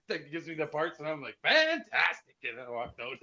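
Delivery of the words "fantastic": very high, exultant exclamation; hammed-up, pitched way up; mock catchphrase. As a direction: toss it out as a gleeful catchphrase. Acting out the catchphrase.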